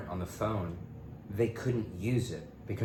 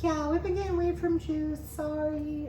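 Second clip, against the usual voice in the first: much higher mock girl voice; imitating Kinsey. Kinsey telling him she switched dealers, high mock voice. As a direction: play her breezy apology in a high put-on voice.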